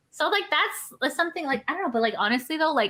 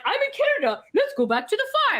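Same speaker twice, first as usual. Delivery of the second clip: mock cheerful; 11 semitones higher than usual. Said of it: "And I'm like".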